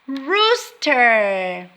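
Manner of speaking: ordinary talk, nothing marked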